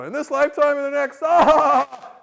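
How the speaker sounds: high, loud shout into a roaring laugh; imitated exuberant holler; booming call-back. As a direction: proclaim it, then burst out "ah!". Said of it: "He's got this roaring laugh".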